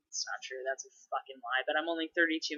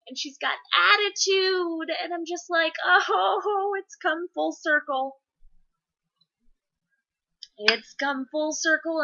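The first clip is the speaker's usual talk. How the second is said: high, hammed-up whiny despair; exaggerated high-pitched lament; mock wailing; 11 semitones higher, 4 dB louder than usual. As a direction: wail like a put-upon parent. Mock despair at daughter's attitude.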